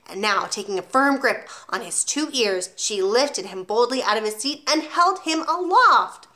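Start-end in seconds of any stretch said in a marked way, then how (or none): none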